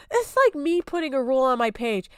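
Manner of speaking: ordinary talk, nothing marked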